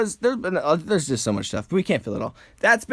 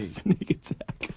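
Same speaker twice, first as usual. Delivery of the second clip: slow unvoiced whisper; 4 semitones lower, 7 dB quieter, much slower than usual. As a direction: whisper like a sneaking fighter. Whispered joke.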